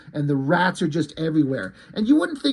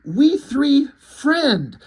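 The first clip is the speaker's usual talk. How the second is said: higher, louder rabbit plea; lightly voiced animal counting out words; rabbit character; 7 semitones higher, 4 dB louder, much slower than usual. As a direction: plead in broken animal speech, pitched up. Voicing the rabbit pleading for peace.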